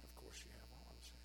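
soft whispered aside. Real, not acted. Preacher to congregation, whispered. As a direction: confide it in a whisper. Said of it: "whispered aside".

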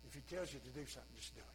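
soft, fast, giving-up tone; resigned. Pretend. Mary giving up to the servants, resigned and soft. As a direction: let it go with a sigh. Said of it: "in resignation she says this".

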